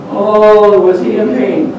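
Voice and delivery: ordinary delivery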